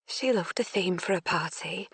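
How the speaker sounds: soft-spoken Yorkshire mum; soft Yorkshire accent. Acted. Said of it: "in a soft Yorkshire accent".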